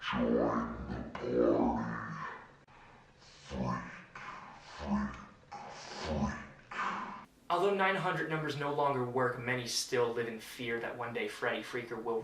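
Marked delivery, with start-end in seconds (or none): 0.1-6.8 deep, slow, whispered demon voice; demonic voice. Retold or imitated. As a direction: growl it low and slow like a demon from another dimension. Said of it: "a deep, almost demonic voice".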